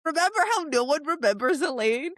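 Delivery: high, loud teasing question; teasing aside. Retold or imitated. Host joking to co-host about Elaine, teasing. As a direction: nudge the listener mischievously.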